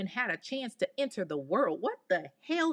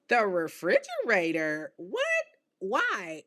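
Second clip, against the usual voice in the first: incredulous. Real, not acted. Disbelief at the killer's answer.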